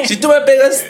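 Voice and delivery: mock threat. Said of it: "o sea". (amenaza burlona, más aguda)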